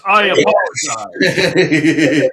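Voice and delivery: high, loud instructor projection. Shown as a demonstration. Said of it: "talking in my instructor voice".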